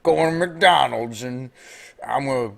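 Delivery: put-on loud, overdone American accent; exaggerated American accent. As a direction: drag it out as a mock American. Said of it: "'Cause we all talk like that".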